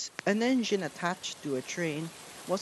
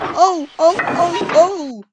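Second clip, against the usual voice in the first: high, loud squealing cries; screaming freight cars; 13 dB louder, slower than usual. A narrator's reading. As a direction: shriek like startled freight cars. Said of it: "screamed the freight cars".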